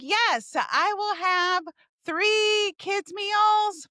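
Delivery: high, drawn-out ordering voice; acted drive-through order.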